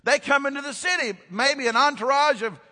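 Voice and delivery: high, shouted, building sermon delivery; full-force preaching. Real, not acted. Preacher telling of wise men entering the city, full-force shout. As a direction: cry it out to the whole congregation.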